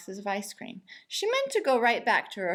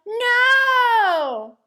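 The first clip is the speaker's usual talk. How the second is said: high, loud shout; group yell; 12 semitones higher, 12 dB louder than usual. A narrator's reading. Family yelling at the cashier, high and loud. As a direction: yell it all together. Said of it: "all yelled".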